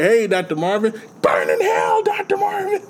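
strained, high comic yell; mock heckling shout. Co-host imitating the couple who hate Marvin, heckling yell. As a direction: holler it like a spiteful neighbor.

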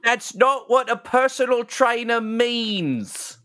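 high, slow creature voice; orc herald. Acted. Orc correcting the impostor, high drawn-out creature voice.

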